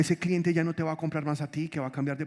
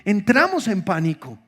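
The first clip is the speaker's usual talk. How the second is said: loud, high preaching climax; preaching at full force; 8 semitones higher, 10 dB louder than usual. Acted. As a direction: drive it home to the congregation. Preacher builds to a peak.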